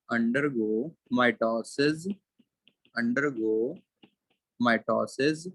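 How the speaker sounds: very slow, word by word; slow dictating voice; dictation pace. Shown as a demonstration. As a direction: read it out slowly for notes. Dictating notes to class.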